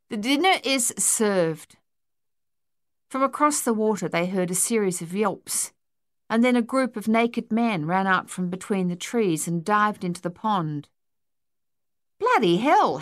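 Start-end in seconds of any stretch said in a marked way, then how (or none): 0.1-1.8 slow, higher-pitched proud announcement; pleased with himself. A narrator's reading. Luther turning to his mates after the bangs, self-satisfied. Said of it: "clearly pleased with himself". 12.2-13.0 high, loud exasperated outburst; loud complaint. A narrator's reading. Harold seeing rivals dive for the fish, complaining loudly. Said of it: "Harold complained".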